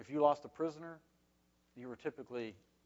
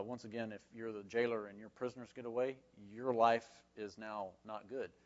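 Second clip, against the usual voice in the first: ordinary talk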